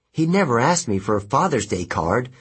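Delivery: plain reading voice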